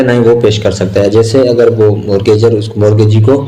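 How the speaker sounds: usual speaking voice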